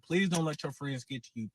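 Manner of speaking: usual speaking voice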